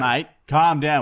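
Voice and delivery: loud, slow order; stern cop command. Pretend. Fake constable to his pretend prisoner, loud stern command. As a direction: firmly order a detainee to settle.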